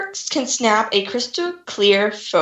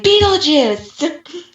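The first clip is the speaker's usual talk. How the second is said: drawn-out, higher, louder call of the name; calling out Beetlejuice; 7 semitones higher, 4 dB louder, much slower than usual. Retold or imitated. Friend mimicking commenters, drawn-out loud Beetlejuice call. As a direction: chant the name like a summoning.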